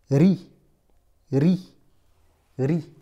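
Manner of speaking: ri with a trilled R; rolled R. Shown as a demonstration. Teacher modelling ri with a rolled R. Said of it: "Ça te donne".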